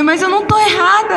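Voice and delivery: quick, high-pitched retold protest; defensive protest. Retelling her protest to the angel.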